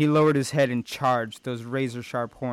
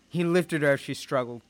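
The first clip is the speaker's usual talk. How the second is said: ordinary delivery, nothing marked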